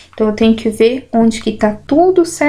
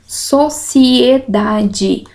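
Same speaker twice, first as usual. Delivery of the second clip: very slow, each syllable apart: So-ci-e-da-de; much slower than usual. Shown as a demonstration. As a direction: sound out Sociedade one syllable at a time. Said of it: "Como que eu digo aqui?".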